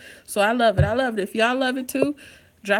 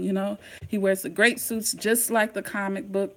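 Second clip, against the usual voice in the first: normal talking voice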